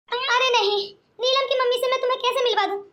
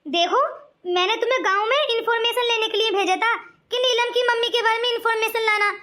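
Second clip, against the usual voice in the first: usual speaking voice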